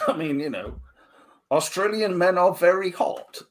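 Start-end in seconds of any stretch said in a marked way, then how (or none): 1.5-3.4 a put-on voice, higher, for a quote; Phantom Cooper voice. Quoting Phantom Cooper to his co-hosts, higher put-on voice. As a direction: announce it like someone else entirely. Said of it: "says Phantom Cooper".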